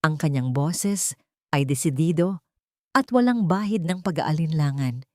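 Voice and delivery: ordinary delivery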